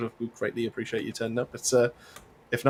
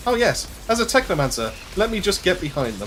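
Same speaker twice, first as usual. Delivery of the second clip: sarcastic; 5 semitones higher, 4 dB louder than usual. Pretend. Sarcastic reply to teammate.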